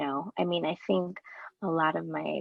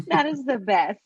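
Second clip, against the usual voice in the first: laughing through the words; 5 semitones higher, 5 dB louder than usual. Real, not acted. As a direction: say it while laughing.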